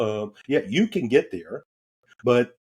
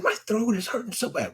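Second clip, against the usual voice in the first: high, soft mimic of his wife in pain; wife complaining; 10 semitones higher, 4 dB quieter than usual. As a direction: imitate her whiny sore-throat plea. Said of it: "she said".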